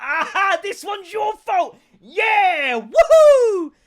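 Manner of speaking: high, loud gleeful crowing; hammed-up victory whoop at his wife; triumphant gloating. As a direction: gloat loudly and high that it's her fault. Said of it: "And I was like".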